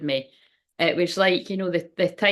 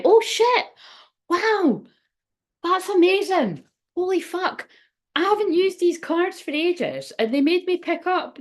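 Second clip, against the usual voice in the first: high, slowed, amazed exclamations; excited surprise; 10 semitones higher than usual. Real, not acted. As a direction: gasp it out, thrilled by the cards. Surprised delight at the cards.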